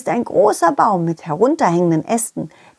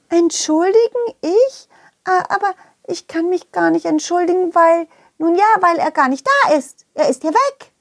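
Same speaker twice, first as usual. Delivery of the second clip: high, startled little creature; high Schwubi voice; 8 semitones higher than usual. Acted. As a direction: play a flustered little Schwubi.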